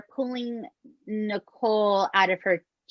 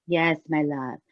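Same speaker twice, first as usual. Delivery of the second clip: a lower, sweet imitation of Frida's voice; lightly voiced gentle spirit guide, lower and sweet; 6 semitones lower than usual. Spirit guide Frida soothing a sitter, in a lower sweet voice. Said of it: "She's very sweet voice".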